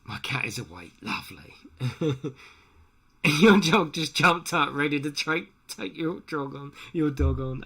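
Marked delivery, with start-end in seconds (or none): 3.4-7.6 louder, higher startled outburst; startled exclamation. Real, not acted. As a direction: blurt it out as the dog leaps up. Dog jumps up, startled.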